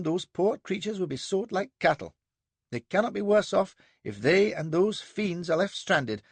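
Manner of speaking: high, firm, resolved; young captain ruling on the slaver's boat; decisive young captain. A narrator's reading. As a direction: snap the decision. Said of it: "Then he snapped".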